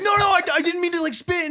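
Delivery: very high mock-frightened voice; imitated panicked pleading. Imitating a driver excusing himself to angry friend, high panic.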